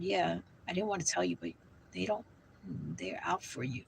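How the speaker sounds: soft, low hush sinking to a whisper; hushed warning. Retold or imitated. To her friend about the spirits, a hushed warning fading to whisper. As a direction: confide the bad news, fading into a whisper.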